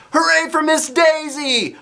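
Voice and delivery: high, loud, slow cheer. Acted. Class cheers for the teacher who saved him. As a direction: cheer her like a crowd of kids. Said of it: "our class cheered".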